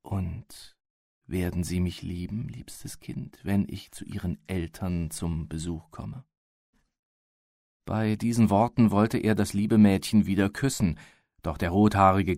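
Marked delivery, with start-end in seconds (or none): 0.0-6.2 a hushed, nearly voiceless plea, much softer; almost breathed under the breath, with a tremble; barely audible, trembling. Acted. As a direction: secret whispered question, voice sinking to nothing. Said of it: "fragte kaum hörbar und bebend".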